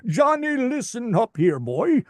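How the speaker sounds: put-on old gruff character. Shown as a demonstration. Voice actor demoing another character voice for Johnny. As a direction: scold Johnny like an old country man. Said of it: "it's all over the place".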